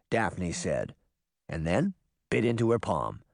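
usual speaking voice

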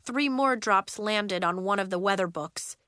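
ordinary delivery, nothing marked

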